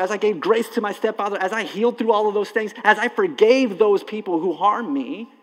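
impassioned pulpit climax; preaching at full force. Acted. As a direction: drive each line up like a sermon peak.

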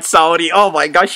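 ordinary talk, nothing marked

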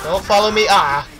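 high, loud panicked shout; panicked yell. Real, not acted. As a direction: yell to shake off the chaser. Chased, yelling.

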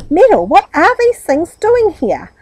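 loud, high demand; shocked cry. A narrator's reading. Aunt shocked by the furniture in the hall, loud. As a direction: demand an answer in alarm. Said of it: "she cried".

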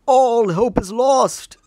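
slow, drawn-out theatrical lament; despairing game character. Acted. Game character bewailing lost hope, slow theatrical despair. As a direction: lament it slowly, like a stage tragedy. Acting out dramatic game dialogue.

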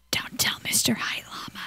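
whisper, no voice; conspiratorial whisper, keep it secret. Real, not acted. Gamer whispering a secret to viewers about the llama.

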